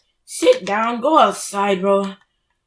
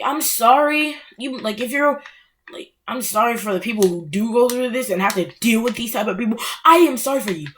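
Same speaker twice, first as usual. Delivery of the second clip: usual speaking voice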